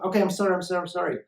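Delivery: acted-out hasty pleading; manipulative apology. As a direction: placate quickly to reel someone back in. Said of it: "they're gonna manipulate you".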